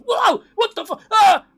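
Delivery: hammed-up fake scream, loud and high; mock YouTuber freakout. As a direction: play the fake streamer jump scare. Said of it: "sitting here going like".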